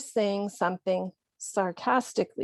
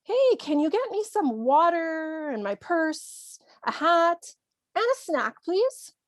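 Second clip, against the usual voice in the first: high-pitched, wheedling list of requests; someone asking favours; 6 semitones higher than usual. Acted. Someone asking you to fetch many things, high-pitched request. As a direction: pitch it up, climbing with each added item. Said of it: "Maybe someone asks you".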